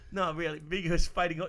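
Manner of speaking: ordinary delivery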